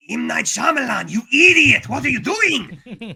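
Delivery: high, loud furious shout at the director; hammed-up exec screaming at M. Night; mock studio exec yelling.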